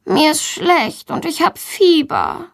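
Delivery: slow, high, pitiful whine; poorly child's plaintive complaint; suffering voice. A narrator's reading. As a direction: plead illness in drawn-out words. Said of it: "mit leidender Stimme".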